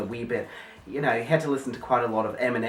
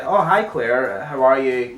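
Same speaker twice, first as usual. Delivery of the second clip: lightly put-on character greeting, louder; Pete voice; 7 dB louder, slower than usual. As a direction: say hello in a slightly put-on voice, louder. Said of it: "you know, Pete voice".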